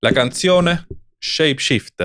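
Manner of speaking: put-on slow, playful Italian accent. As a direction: announce it like a hammy Italian. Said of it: "mit italienischem Akzent".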